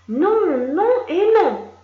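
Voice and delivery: loud, high, insistent no; stubborn shouted no. Acted. Child shouting no at the adult. Child refusing, frame dialogue.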